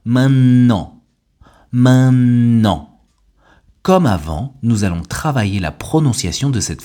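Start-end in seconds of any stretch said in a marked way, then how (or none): 0.0-1.0 "maintenant" as main-nnant, no T, loud and higher; double N. Shown as a demonstration. Teacher demonstrates spoken French, insistent double N in "maintenant". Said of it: "remplacé par un double N". 1.7-3.0 "maintenant" very slowly as main-nnnant, no T; double N. Shown as a demonstration. Teacher demonstrates for learners, drawn-out double N in "maintenant". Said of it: "remplacé par un double N".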